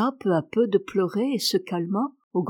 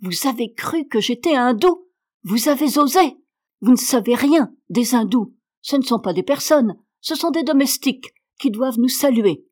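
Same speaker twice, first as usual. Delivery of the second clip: higher and louder, hammering each "rien"; raging; 3 semitones higher, 5 dB louder than usual. Acted. Girl raging at the maid who thought her Indian, furious shouting. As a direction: spit it out in humiliated rage. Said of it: "sa rage et son humiliation".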